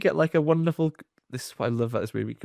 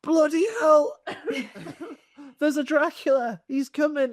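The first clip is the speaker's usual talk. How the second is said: mock panic. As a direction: squeal it out like a startled crowd. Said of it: "They go".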